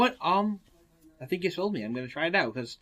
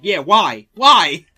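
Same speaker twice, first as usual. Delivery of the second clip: defensive indignant; 6 semitones higher, 18 dB louder, much slower than usual. Retold or imitated. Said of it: "It's like".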